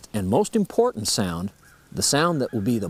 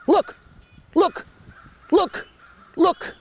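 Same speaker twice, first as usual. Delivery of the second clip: very high, loud sharp "Look!" bursts; loud danger putt; 4 dB louder, slower than usual. Shown as a demonstration. A turkey warning the flock of danger, loud alarm. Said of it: "a cluck that's given very loud".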